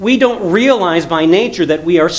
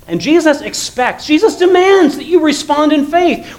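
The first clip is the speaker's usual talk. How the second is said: rising preaching emphasis; 10 semitones higher than usual. Real, not acted. Preaching climax on Jesus' demand.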